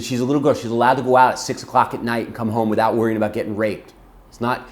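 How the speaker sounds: loud, insistent retold rebuke; furious. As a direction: argue hotly for the little girl. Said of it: "I'm so furious".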